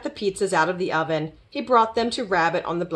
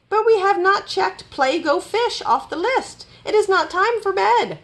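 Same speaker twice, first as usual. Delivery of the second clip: high-pitched indignant protest; 12 semitones higher than usual. A narrator's reading. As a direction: object up high, insistently. Rabbit protests Robot going to sleep.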